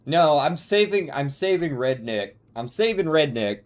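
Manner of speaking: usual speaking voice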